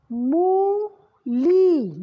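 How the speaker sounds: मूली drawn out, loud and slow; stretched word. Shown as a demonstration. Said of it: "ला पे ई की मात्रा है".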